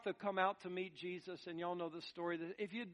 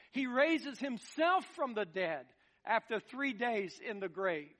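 higher, forceful pulpit proclamation; impassioned preaching; 5 semitones higher, 5 dB louder than usual. Real, not acted. Preacher proclaiming Jesus' power over death, impassioned preaching. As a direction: proclaim it with rousing conviction.

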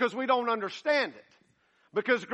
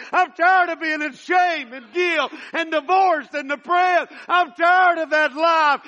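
voicing the woman's plea, building to a shout; 7 semitones higher, 12 dB louder than usual. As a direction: start level, climb to a desperate loud plea for freedom. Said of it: "And she said".

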